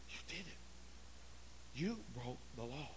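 low, very soft awe; hushed shock. Acted. Leper astonished the law was broken, hushed shock. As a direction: breathe it out, scandalized. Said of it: "Naaman looked around and said".

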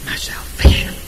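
soft, hushed whisper. Acted. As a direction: drop to a whisper. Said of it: "sudden whisper after shouting".

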